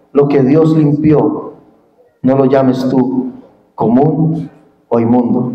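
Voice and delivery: low, slow, solemn divine voice; voice of God. Acted. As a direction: deliver God's command low and slow. Voicing God's command to Peter.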